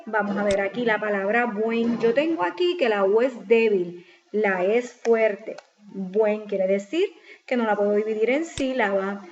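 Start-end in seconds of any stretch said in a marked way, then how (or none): none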